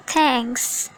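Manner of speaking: normal talking voice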